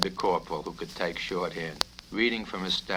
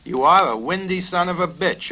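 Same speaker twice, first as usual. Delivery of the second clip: loud, high, furious jab; angry insult; 10 semitones higher, 10 dB louder than usual. Acted. Colonel insulting the cadet, loud fury. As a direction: hurl the insult at him.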